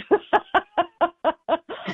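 laughing at her own story; real laugh. Real, not acted. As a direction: burst into a long high giggle. Laughs at pot delivery job.

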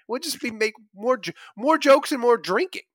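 ordinary talk

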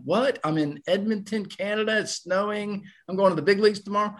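high-pitched, questioning replay of astonishment; acting out dazed amazement, pitch held high; can't-believe-it retelling; stunned disbelief. Retelling his stunned reaction to call-up.

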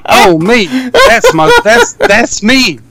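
laughing at hearing his own voice; amused realization through laughter; laughing recognition. Retold or imitated. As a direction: catch yourself and crack up. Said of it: "And I'm like".

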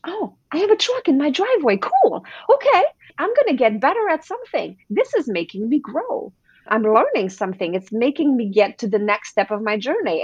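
high, eager and curious inner voice; bright, delighted reframing; excited self-talk. Shown as a demonstration. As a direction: talk yourself into the thrill of it. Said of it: "choose that it's excitement or curiosity".

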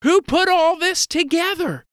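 high-pitched voice of an astonished mother; shocked mom. Acted. Mom finding a surprise breakfast in the kitchen, high and shocked. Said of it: "is shocked to see everything".

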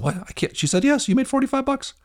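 high-pitched, delighted shock; excited disbelief. Retold or imitated. Dad reacting to son landing the job, excited disbelief. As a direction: squeal it out as if you can't believe the good news.

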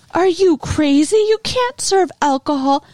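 high-pitched mock outrage; retold indignant outburst. Student president scolds party host, mock indignant.

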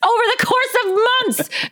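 very high, screamed mock confession; confessing murderess. Woman confesses her kill at SoulCycle, high mock scream. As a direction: wail it out like a class-high confession. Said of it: "Some woman in there is like".

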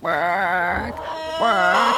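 strained mock chicken bark-squawk; imitated chicken squawk. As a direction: squawk it out like a hen in a box. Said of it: "It's like".

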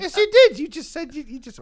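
high, loud mock outrage; jokey accusation at cohost; mock indignant. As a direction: play offended, over the top.

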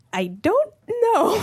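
high, slow, squeaky uncertainty; comically timid high voice; nervous answer. Retold or imitated. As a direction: stretch it out in a high, scared squeak. Nervous answer about being murdered.

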